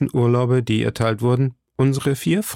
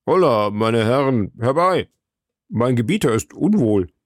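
alarmed servant's call, lightly acted; calling for help. Planchet summons the friends to his unwell master, calling out. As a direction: call out for the gentlemen to come.

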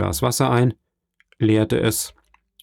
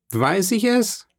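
much higher, innocently evasive question; evasive shrug; 11 semitones higher, slower than usual. A narrator's reading. Danglars evading Caderousse about Fernand, high evasive shrug. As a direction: toss it off as if you couldn't know.

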